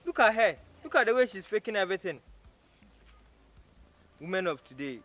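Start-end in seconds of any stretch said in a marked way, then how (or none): 0.1-2.2 loud, higher-pitched scorn; accusing outburst. Acted. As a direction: call out the faker loudly. Man accuses her of faking.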